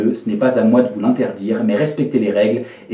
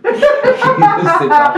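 high nervous laugh through words; giggling as it slips out slowly; 6 dB louder, much slower than usual. Real, not acted. Nervous laugh during seance. (rire nerveux aigu)

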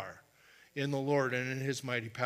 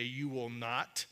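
slow, firm and quiet command; calm authority; 5 semitones lower, 9 dB quieter, slower than usual. Shown as a demonstration. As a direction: state it slowly with quiet authority. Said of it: "I say".

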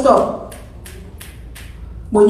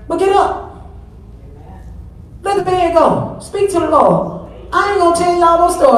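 raised pitch preaching call; exhorting; 6 semitones higher than usual. Acted. Preacher urging mothers to rise and pray, raised call. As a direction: call them up out of bed, high.

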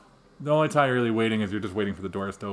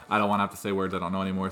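fast, hurried read-through; rushing before cutoff; 3 semitones lower, much faster than usual. Real, not acted. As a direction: speed through to get it over with. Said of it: "I'm speaking really fast to get through".

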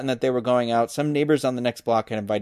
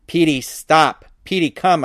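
restrained yell, a bit louder and higher; exasperated yell; 3 semitones higher, 4 dB louder, slower than usual. A narrator's reading. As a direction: call the dog with mild exasperation. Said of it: "I yelled, exasperated".

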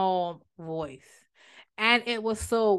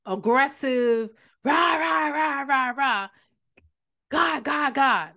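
mock shouting, higher and drawn out; loud, aggressive; 4 semitones higher, much slower than usual. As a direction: imitate noisy, aggressive ranting in repeated bursts. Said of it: "we think loud, aggressive".

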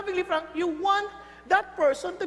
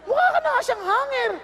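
mocking gossip; 8 semitones higher, 5 dB louder than usual. Gossip behind a friend's back.

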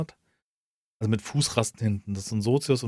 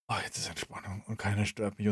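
relieved sleepy sigh; 4 semitones lower, 10 dB quieter than usual. Retold or imitated. Relaxed thought lying back down.

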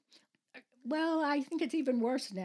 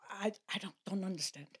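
put-on softer, hesitant Russian-accented grandmother; 3 semitones lower, 8 dB quieter than usual. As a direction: imitate a baffled grandmother's Russian accent, quietly. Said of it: "with her Russian accent".

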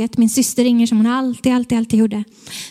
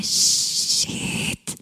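long whispered gasp of a word; stunned whisper; 6 dB quieter than usual. Retold or imitated. Patient realising what the call means, stunned whisper. Stunned thought on hearing news.

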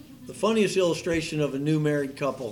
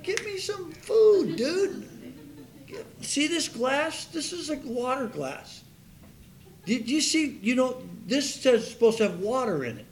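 much higher, mock-indignant diner voice; squeaky fake customer griping about an empty water glass; demanding customer; 9 semitones higher than usual. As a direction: whine at the lazy waiter, hammed up. Impatient customer scolding idle waiter.

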